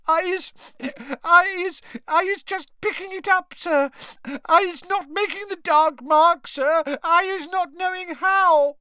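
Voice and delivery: very high squeaky elf voice, tearful; squealing house-elf. A narrator's reading. Terrified house-elf pleading with the ministry wizard, squealing. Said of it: "squealed Winky".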